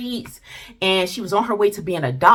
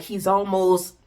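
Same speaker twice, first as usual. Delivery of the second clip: usual speaking voice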